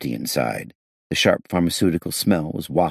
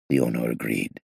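plain reading voice

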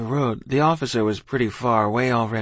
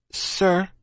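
higher-pitched respectful youngster; polite child; 8 semitones higher than usual. A narrator's reading. Child asking a British officer, polite and high.

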